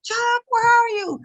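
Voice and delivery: high, frantic retold scream; high-pitched panicked call. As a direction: scream out for someone you can't find.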